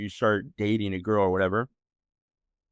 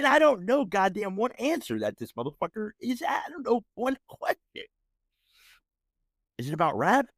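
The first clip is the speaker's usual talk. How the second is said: incredulous rant; 8 semitones higher than usual. Real, not acted. To listeners, mocking the girlfriend's trivia night, high incredulous rant. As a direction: sneer at the whole idea, voice climbing high.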